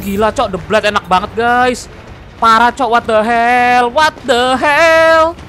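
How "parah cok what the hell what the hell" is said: loud, long drawn-out exclamation; excited disbelief. Real, not acted. Streamer reacting to a great play, excited disbelief.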